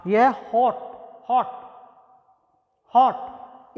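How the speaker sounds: हॉट with a short open o, not होट or हार्ट; model pronunciation. Shown as a demonstration. Teacher to students, correcting the vowel, clear demo. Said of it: "ना ही तो होट और ना ही हार्ट".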